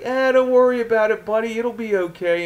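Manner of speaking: high-pitched, dismissive promoter patter; sleazy wrestling promoter. Acted. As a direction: brush off worries like a sleazy promoter. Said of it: "fast-talking wrestling promoter sleaze bag".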